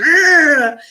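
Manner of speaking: acted-out "ah" of menace; aggressive snarl. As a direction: imitate an angry creature's growl. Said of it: "a very aggressive C car with angry eyes".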